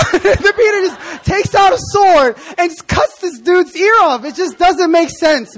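high, loud, laughing exclamation; laughing disbelief. Real, not acted. Preacher laughing in disbelief to the congregation, high and loud. Incredulous laughing outburst about Peter.

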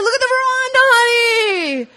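high, loud gushing, imitating excitement; thrilled exclamation. Husband showing wife the veranda, thrilled. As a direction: call out in hammed-up delight.

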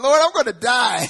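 mock despair. Melodramatic prayer while fasting.

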